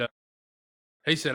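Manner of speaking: ordinary talk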